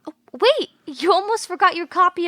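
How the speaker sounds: sudden high call to stop him; urgent shout after a friend. Acted. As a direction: catch him before he leaves. Calling after a departing friend.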